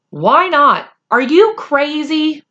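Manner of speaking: can't believe he'd skip skating; incredulous Patrick. A narrator's reading. Patrick baffled that Omri won't skateboard, loud boyish disbelief.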